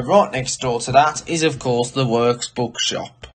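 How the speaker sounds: ordinary talk, nothing marked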